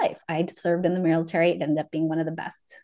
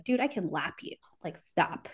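under-breath retort; 9 dB quieter than usual. Retold or imitated. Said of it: "of course I wouldn't say that out loud".

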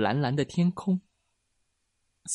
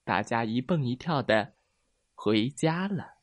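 usual speaking voice

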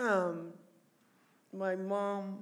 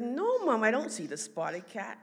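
high, loud retold "no"; emphatic denial; 4 semitones higher, 8 dB louder than usual. Sister telling mom there is no cat, emphatic denial. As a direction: insist as the puzzled sister, starting high.

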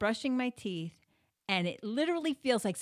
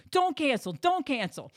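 raised, louder retold command; urgent insistence; 6 semitones higher, 6 dB louder than usual. Wife stopping husband calling the hospital, loud urgent insistence. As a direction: cut in urgently, higher and louder.

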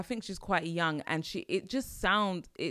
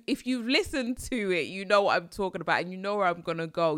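ordinary delivery

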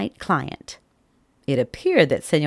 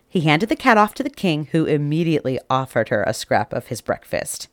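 plain reading voice

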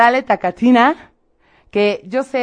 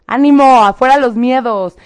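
loud, higher rallying call; rousing cheer; 4 semitones higher, 6 dB louder than usual. Real, not acted. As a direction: cheer them on, stretching the second phrase. Cheering on the message's recipient.

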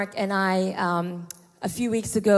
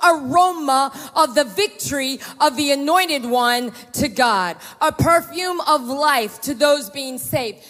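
slow, emphatic preaching voice; fervent preaching; 6 semitones higher, 6 dB louder than usual. Acted. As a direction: preach it slowly to the church. Preaching to congregation.

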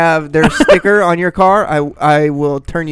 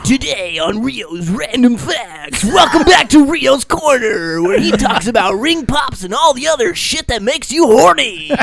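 announcer voice; 6 semitones higher than usual. Pretend. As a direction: hype it up like a TV host. Mock show intro.

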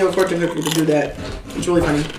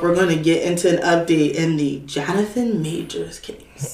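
whispering; 5 semitones lower, 6 dB quieter than usual. Real, not acted. Gossip teaser, whispered.

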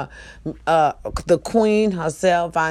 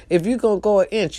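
normal talking voice

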